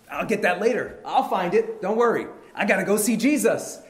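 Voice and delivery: loud, high, hurried resolve; eager urgency. Acted. Blind man throws aside his cloak for Jesus, urgent. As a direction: brush it off loudly, eager to go.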